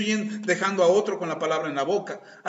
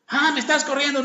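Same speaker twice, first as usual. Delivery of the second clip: higher mock offended reply; 6 semitones higher than usual. The other person feeling kicked out of the house. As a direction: take it as being thrown out. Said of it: "other party in acted quarrel".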